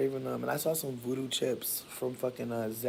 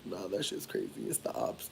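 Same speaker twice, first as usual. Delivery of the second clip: imitated friend; 7 semitones lower, 5 dB quieter than usual. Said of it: "'cause they'll be like".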